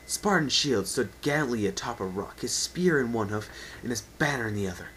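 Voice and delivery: ordinary delivery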